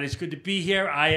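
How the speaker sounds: ordinary talk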